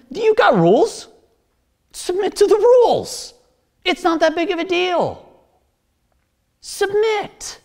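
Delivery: high, emphatic preacher acting out orders; blunt acted command. Preacher voicing Jesus telling people to submit to rules, blunt and high. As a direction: push each short answer high and insistent.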